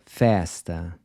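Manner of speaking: Festa with an open E, like the E in air; Festa, mouth wide on the E. Shown as a demonstration. Teacher models Portuguese Festa with an open E.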